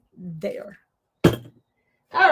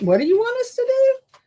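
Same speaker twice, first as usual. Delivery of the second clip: much higher, louder, incredulous question; exasperated disbelief; 14 semitones higher, 7 dB louder than usual. Real, not acted. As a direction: throw up your hands at the ribbon. Exasperated at fiddly ribbon.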